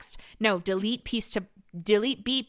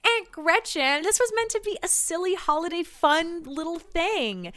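mock sweet; 7 semitones higher than usual. Mock sweet reply to aunt.

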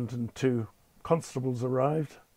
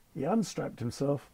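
ordinary delivery

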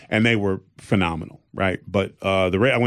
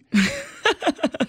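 high laugh. Real, not acted. Dad laughing at his daughter's roast, high burst.

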